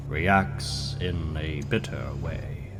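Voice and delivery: ordinary talk, nothing marked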